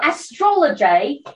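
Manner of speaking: bad put-on Australian voice; Australian accent. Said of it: "my Australian accent blows".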